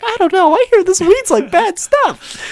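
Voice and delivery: put-on very high, loud hesitant child; little Hitler. Sketch kid being offered weed, high-pitched mock worry. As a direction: squeak out a wholesome kid's reluctance. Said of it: "He's like".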